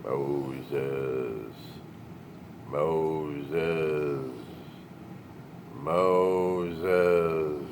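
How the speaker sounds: low, slow, booming divine call; solemn, echoing movie God. Retold or imitated. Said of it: "God trying to catch his attention".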